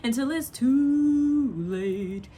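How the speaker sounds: very slow, stretched-out lament; dramatic lyric quote. Retold or imitated. Quoting the song's ending, very slow and mournful. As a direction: drag each word out mournfully. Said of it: "He's so dramatic in that".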